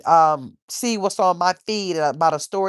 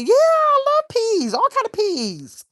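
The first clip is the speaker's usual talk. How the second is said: high, slow, sing-song gushing; gushing delight; slower than usual. Real, not acted. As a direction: swoon over the food in a high drawn-out voice. Gushing about food to chat.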